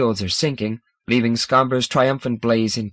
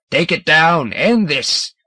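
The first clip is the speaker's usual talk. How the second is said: loud, higher shouted demand; shouting; 5 semitones higher, 5 dB louder, slower than usual. A narrator's reading. Seniors echoing Hegrin, shouting for the beam's end. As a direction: chant the demand together. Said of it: "several seniors echo him".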